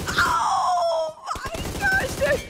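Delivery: very slow, high awed cry; drawn-out amazed shout. Real, not acted. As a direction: stretch it out in awe. Awed by the play.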